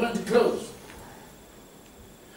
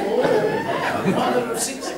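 mock crowd reaction; 2 semitones lower, slower than usual. Party guests reacting to the nickname, mock knowing echo. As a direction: imitate onlookers going "oh" at gossip. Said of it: "Everybody says".

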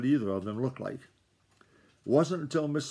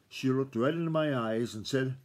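ordinary delivery

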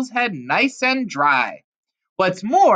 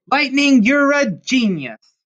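delighted praise; 5 semitones higher, 5 dB louder than usual. A narrator's reading. Bill praises his stallion.

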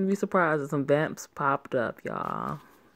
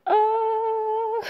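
excited scream; 5 dB louder than usual. Real, not acted. Reacting to the golden eyes.

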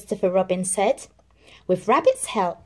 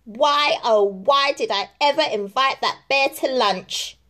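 moaning; 5 semitones higher than usual. Acted. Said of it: "moaned Rabbit".

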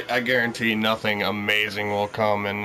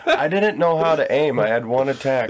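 raised, higher-pitched mock-indignant defence; loud protest; 4 semitones higher, 5 dB louder than usual. Real, not acted. Gamer defending a bad score to laughing friend, loud protest.

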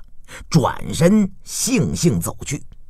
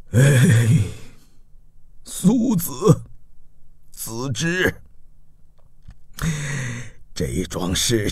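put-on low, soft old ruler after weeping; sigh, then gather yourself to move on; subdued, sorrowful lord; weary grief; 4 semitones lower, 3 dB quieter than usual. A narrator's reading. Grieving duke turns back to business.

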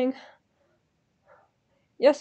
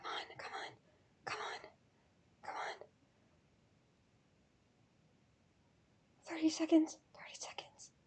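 barely audible, whispered pleading; anxious whisper; 19 dB quieter than usual. Real, not acted. Fan watching the last thirty seconds, anxious whisper. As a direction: whisper to yourself as time runs out. Urging countdown under her breath.

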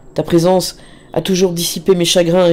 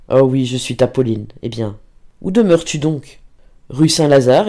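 plain reading voice

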